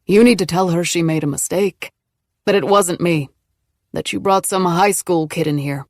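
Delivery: low angry voice. A narrator's reading. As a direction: threaten her coldly. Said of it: "one low, angry voice".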